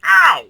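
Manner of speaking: very high, very loud real yelp; piercing pained ow; loud cry of pain. Real, not acted. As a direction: scream out at the sharp pinch. Hurt again on retry.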